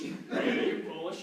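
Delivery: low, slow pronouncement; repeated diagnosis. Acted. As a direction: drop the voice and draw out the diagnosis. Diagnosis said over, much lower.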